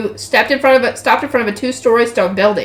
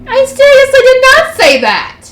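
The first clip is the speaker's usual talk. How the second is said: high, loud outburst of denial; indignant protest; 13 semitones higher, 5 dB louder than usual. Acted. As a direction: protest at the top of your voice. Accused character protests heatedly.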